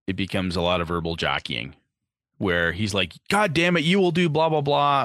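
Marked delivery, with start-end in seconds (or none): 3.3-5.0 angry boss. Acted. NPC boss voiced angrily.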